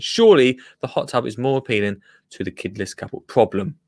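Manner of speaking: ordinary talk, nothing marked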